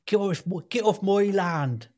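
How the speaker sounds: slow, mock Wiltshire farmer accent. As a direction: drawl it like a Wiltshire farmer shooing you away. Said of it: "reassuring Wiltshire accent".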